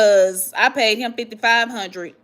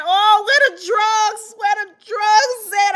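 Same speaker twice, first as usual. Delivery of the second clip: high, drawn-out wail for drugs; mock crying; 9 semitones higher than usual. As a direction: cry it out in a hammed-up plea. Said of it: "I was crying".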